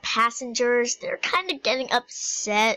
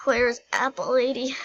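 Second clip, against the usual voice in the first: normal talking voice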